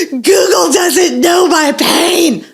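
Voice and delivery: high, slow mock sob; gasp, then wail with tragic grandeur; melodramatic child. Mom imitating child refusing comfort, melodramatic wail. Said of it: "she's just like".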